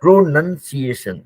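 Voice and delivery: Pronunciation drawn out to show the lip work; very slow Pronunciation with clear "nun"; slow articulated pronunciation. Shown as a demonstration. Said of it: "muscles को काम पे लगाते हैं".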